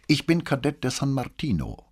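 as if anything else were unthinkable; self-evident superiority. A narrator's reading. Cadet naming his academy to the police, haughtily self-evident. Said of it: "als ob es undenkbar wäre".

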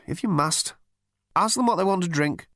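high-pitched, grudging permission; reluctant captain. A narrator's reading. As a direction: concede it up high.

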